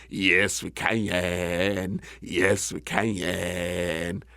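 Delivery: low, croaky-slow bullfrog reply; deep frog voice, not truly shouted; frog calling back. Acted. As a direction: answer deep, stretching the last word. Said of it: "Frog shouted back".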